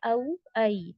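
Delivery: औ and ऐ glided softly; softly said diphthongs. Shown as a demonstration. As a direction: say औ ऐ gently and lightly. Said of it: "बिल्कुल भी नरमी से अदा करना है".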